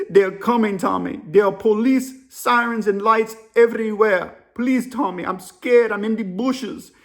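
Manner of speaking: put-on urgent African-accented plea; imitated panicked African caller. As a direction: African accent, emphatic and begging. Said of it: "The African dude's whispering".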